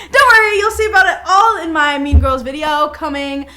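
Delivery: loud laughing plug. Real, not acted. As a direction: laugh through the announcement. Laughing while plugging video.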